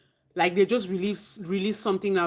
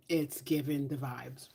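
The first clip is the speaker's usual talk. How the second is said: whispering; 4 semitones lower, 8 dB quieter than usual. Real, not acted. Confiding aside.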